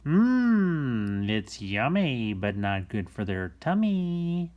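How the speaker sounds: yummy voice. Acted. Savoring candy rhyme.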